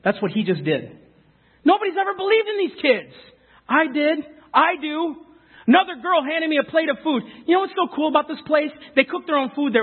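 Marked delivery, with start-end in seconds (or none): 1.6-9.8 raised, fervent preaching voice. Acted. A preacher urges his congregation, fervent raised preaching. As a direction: preach it up with rising conviction.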